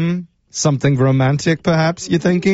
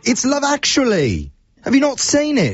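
put-on British accent; 10 semitones higher than usual. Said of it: "use my absolute worst British accent".

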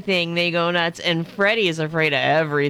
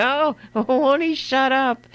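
mock exasperation; 7 semitones higher, slower than usual. Imagined passers-by's annoyed private thought.

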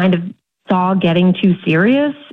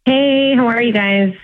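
chipper, higher-pitched hello; 4 semitones higher than usual. Real, not acted. Caller saying hello to the radio hosts, chipper. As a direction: greet them in a cheery, lifted voice. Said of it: "You sound chipper".

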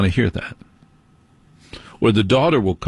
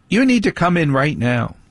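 higher, bossy mock teen; imitating daughter; 6 semitones higher than usual. Dad mimics his daughter calling him inside right away. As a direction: insist like an impatient daughter. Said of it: "daughter will come out and go".

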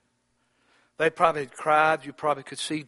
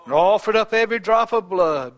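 ordinary talk

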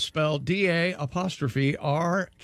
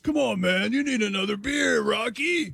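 mock drinking buddy; 5 semitones higher than usual. Joking about a player called Rocky Beers, mock drinking-buddy urging. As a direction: lightly play a pal pushing another drink.

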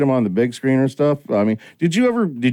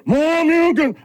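high mock fighting-game shout; imitating game shout; slower than usual. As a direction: yell it like a Street Fighter move. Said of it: "I'm like, they go".

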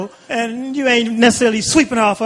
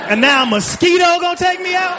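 outraged disbelief; 9 semitones higher than usual. Real, not acted. Outraged punchline.

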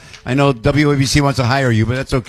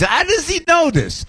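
amazed. Real, not acted. Host marvelling at teen's knowledge.

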